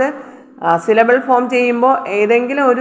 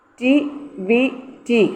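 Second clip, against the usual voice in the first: Activity very slowly with long gaps, softer; syllable by syllable; 5 dB quieter, much slower than usual. Shown as a demonstration. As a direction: leave a pause between syllables of activity. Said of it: "ടി ഐ syllable".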